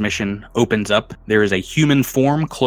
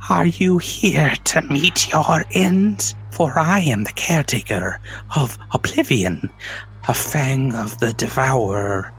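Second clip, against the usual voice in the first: sway and intone like a spectre; high ethereal voice. Acted. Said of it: "speak in this high, ethereal voice".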